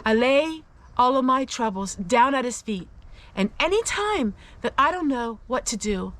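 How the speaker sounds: normal talking voice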